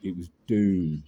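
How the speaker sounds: doom, slow and ominous; slow, heavy doom. Real, not acted. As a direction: drag out it was doom, weighty.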